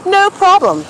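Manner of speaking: high, loud, eager agreement; cheerful no problem. Retold or imitated. Stranger agreeing to buy vodka, cheerful and high. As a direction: say it happily, up high. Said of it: "And he goes".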